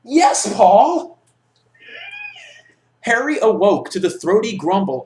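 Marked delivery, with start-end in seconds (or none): 0.0-1.2 much higher, louder gleeful yells; excited shouting. Real, not acted. Reader cheers to his friend about the best chapter, excited shouting.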